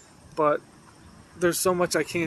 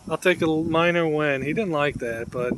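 ordinary delivery

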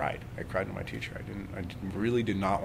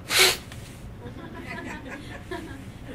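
high laugh at the silly demo; high giggle; 3 dB quieter than usual. Real, not acted. Teacher laughing at her own demonstration, high giggle. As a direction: giggle at the joke.

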